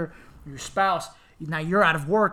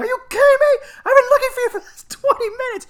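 much higher, louder, laughing outrage; exasperated disbelief; 11 dB louder than usual. Retold or imitated. Answering grandmother after searching for her, laughing shriek.

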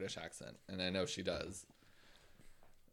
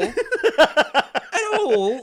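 very loud, high mock annoyance; hammed-up British mum yell through laughter; 16 dB louder than usual. Singer getting annoyed with her kid, hammed-up annoyance. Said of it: "[name] getting annoyed with her kid".

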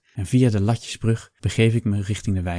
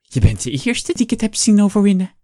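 high, louder lisping hag; lisping neighbour; 11 semitones higher, 5 dB louder than usual. A narrator's reading. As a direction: sneer it down at the patient. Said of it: "slist ze".

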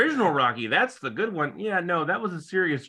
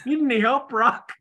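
eager fat guy; 10 semitones higher, 5 dB louder than usual. Retold or imitated. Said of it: "The fat guy rushes over and says".

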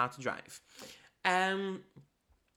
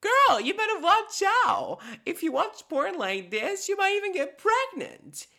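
very high, loud squeaky cartoon voice; squeaky vagina character; 7 dB louder than usual. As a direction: play the puppet girlfriend, giddy and shrill-high. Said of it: "vagina goes to her".

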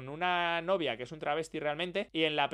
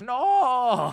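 sharp loud no; 8 semitones higher, 9 dB louder than usual. Real, not acted. Host refusing to drop the rating, loud sharp shout. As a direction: shout it higher and louder.

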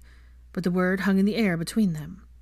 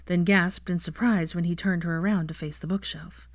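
plain reading voice

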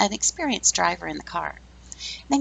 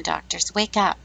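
usual speaking voice

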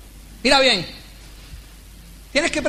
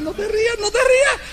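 rising playful plea; 7 semitones higher than usual. Acted. Pleading audience not to laugh.

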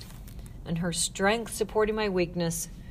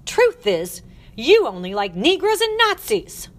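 loud, high, climbing outburst; furious accusation; 8 semitones higher, 9 dB louder than usual. Acted. Matron accusing the girl at her peak, furious. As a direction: hurl the charge at full voice.

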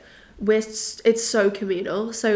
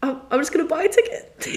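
laughing; 8 semitones higher, 5 dB louder than usual. Real, not acted. Laughing while recalling a ticket.

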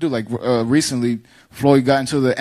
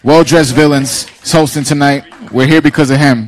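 loud announcer voice; rally the crowd, big and loud; animal show trainer; 9 dB louder than usual. Acted. Introducing guest to audience.

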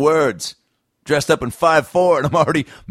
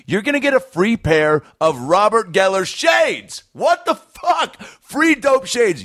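hyped outburst; 7 semitones higher, slower than usual. Real, not acted. Hyped reaction in ad read.

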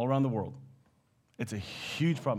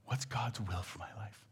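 softer, whispered worry; whispered inner question; 5 semitones lower, 12 dB quieter than usual. Retold or imitated. Preacher voicing a believer's secret doubt in a whisper.

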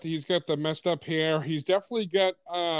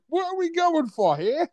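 incredulous; 10 semitones higher than usual. Retold or imitated. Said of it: "I'm like".

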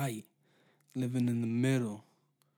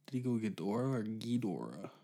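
muttered aside; 7 dB quieter than usual. Retold or imitated. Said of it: "He's like".

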